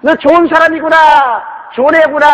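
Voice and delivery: put-on very high child's holler; calling out kindly. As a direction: shout praise across the mountains. Child calls nice words.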